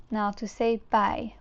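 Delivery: ordinary talk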